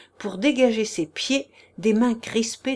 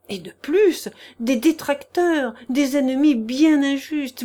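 desperate plea. Acted. Chichikov begs the prince for mercy, raised and desperate. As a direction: beg him with theatrical self-pity. Chichikov's tearful pleading confession.

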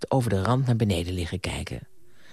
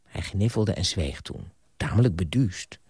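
usual speaking voice

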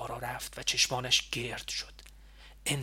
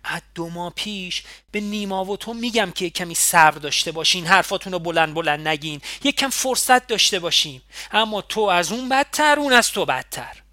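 scolding that builds louder and higher; heated reproach; 4 semitones higher, 7 dB louder than usual. A narrator's reading. Ali rebuking her and Nima for talking openly, growing loud and heated. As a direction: lay into her with mounting frustration. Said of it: "با حرص دستش را به سمت من دراز".

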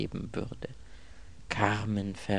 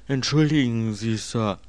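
higher, louder servant's voice; a polite servant begging pardon; apologetic manservant, pitched up; deferential servant; 4 semitones higher, 5 dB louder than usual. Acted.

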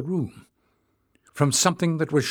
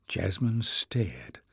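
usual speaking voice

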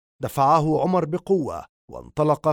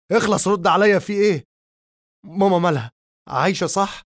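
high, loud frantic yelling; shouting; 5 semitones higher, 5 dB louder than usual. Acted. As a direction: yell it desperately in his face. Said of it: "فصرخ فيه".

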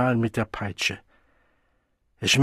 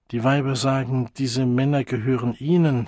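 usual speaking voice

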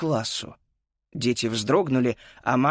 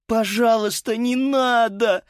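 sobbing; 10 semitones higher, 3 dB louder than usual. Acted. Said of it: "зарыдала Фрида".

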